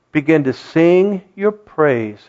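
ordinary talk

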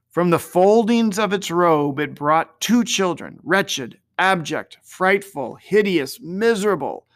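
ordinary delivery, nothing marked

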